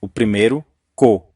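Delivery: co said alone and leaned on as the stressed syllable; stressed syllable shown. Shown as a demonstration. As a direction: isolate co as the strong syllable. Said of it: "qual é a sílaba tônica?".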